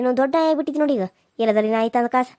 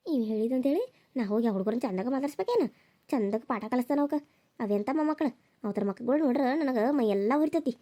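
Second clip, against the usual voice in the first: much softer, muttered to herself; spiteful muttered aside; 8 dB quieter than usual. Acted. Mother-in-law mutters her grudge aside, much softer. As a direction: grumble under her breath so nobody hears.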